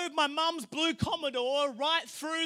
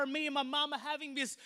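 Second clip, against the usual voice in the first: ordinary delivery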